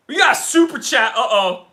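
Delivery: loud, high-pitched hype call; excited announcement. Real, not acted. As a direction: announce it to the stream with a shout. Announces a super chat to stream.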